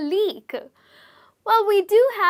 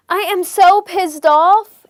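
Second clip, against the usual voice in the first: put-on loud, higher, demonstrative drunk outburst; mock drunk exclamation; 4 semitones higher, 10 dB louder than usual. Shown as a demonstration. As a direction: call it out like a tipsy Brit, mildly acted. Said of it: "this person is so drunk right now".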